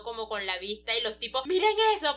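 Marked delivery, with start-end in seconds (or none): 1.5-2.2 mild mock cry of alarm, higher and louder; lightly acted, high-pitched alarm; alarmed exclamation. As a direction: call it out in retold alarm. Alarmed men in retold scene.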